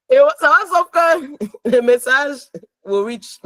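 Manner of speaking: giggling through the words; laughing. Real, not acted. Wife telling the church her answer, laughing. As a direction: laugh as you get it out.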